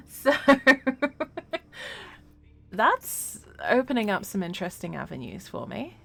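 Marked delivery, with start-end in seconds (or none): 0.4-2.7 delighted laugh. Real, not acted. Laughs at new armor option.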